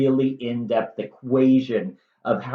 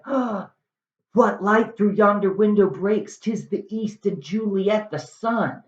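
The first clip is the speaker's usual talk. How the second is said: lovestruck Romeo; 6 semitones higher than usual. Acted. Romeo quoting Shakespeare in garden.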